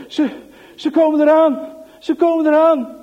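breathless, loud panic from running; panting alarm. Acted. Piet bursting in out of breath, loud panic. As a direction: gasp it out after a hard run. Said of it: "Hijgend van het harde hollen".

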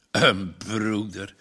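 a low, slow scoffing echo; mocking repeat. Farmer echoes Margje's word, low and scornful.

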